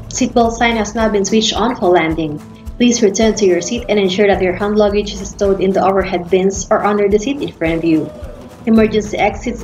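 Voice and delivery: ordinary talk, nothing marked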